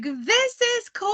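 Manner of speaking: delighted high squeal; excited. Retold or imitated. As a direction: gush like you're thrilled. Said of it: "I was like".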